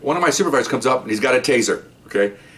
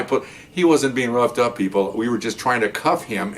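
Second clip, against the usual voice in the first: normal talking voice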